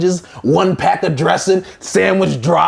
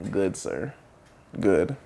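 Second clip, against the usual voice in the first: soft low reluctant reply; quiet mumble; 9 semitones lower, 9 dB quieter, slower than usual. Retold or imitated.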